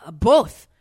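one loud word in a mock French accent; put-on French receptionist. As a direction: imitate a French desk clerk. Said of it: "with a very heavy French accent".

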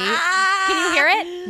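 crying laughing. Real, not acted. Laughing at wire noise.

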